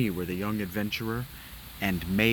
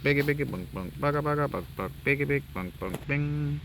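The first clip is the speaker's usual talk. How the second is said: humming soundtrack; 4 semitones higher than usual. Shown as a demonstration. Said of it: "kinda like what the soundtrack sounds like".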